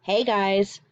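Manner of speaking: ordinary talk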